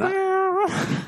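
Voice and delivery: lightly growled animal noise; a hint of a beast growl; dinosaur voice. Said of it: "auditioning various sex noises".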